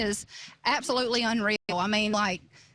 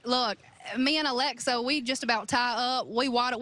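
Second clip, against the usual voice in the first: normal talking voice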